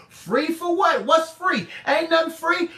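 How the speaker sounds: ordinary talk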